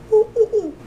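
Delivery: high delighted little ooh ooh ooh; thrilled cooing at spotting the helper; excited squeals. Retold or imitated. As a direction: squeal with relief at finding help. Spotting a store helper at last.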